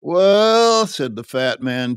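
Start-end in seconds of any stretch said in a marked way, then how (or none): 0.0-0.9 high, loud, drawn-out "well"; disgusted. A narrator's reading. Fat man turning from the emotional display, disgusted. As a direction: heave out a long dismissive interjection. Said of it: "turning a disgusted shoulder".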